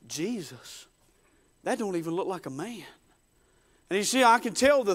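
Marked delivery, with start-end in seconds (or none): 0.1-2.8 soft, low disbelief; hushed shock. Acted. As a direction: say it softly, as if unable to believe the sight. Simon's shock at seeing Jesus.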